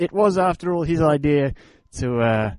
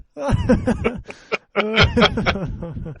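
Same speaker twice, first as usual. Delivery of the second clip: hearty laugh; 9 semitones higher than usual. Real, not acted. Laughing after shout.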